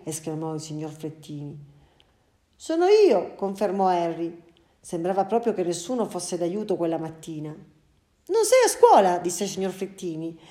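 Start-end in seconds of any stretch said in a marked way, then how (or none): 2.6-3.3 put-on high, loud little boy; boy's voice. Boy Harry's voice. 7.5-9.2 puzzled father. Acted. Father speaks to son.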